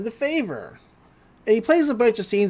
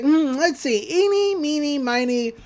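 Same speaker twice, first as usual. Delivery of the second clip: teasing villain; 6 semitones higher, slower than usual. Retold or imitated. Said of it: "He's like".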